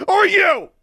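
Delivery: very high, loud screamed accusation; furious shout. A narrator's reading. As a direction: shout with bitter outrage. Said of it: "Harriet shouted".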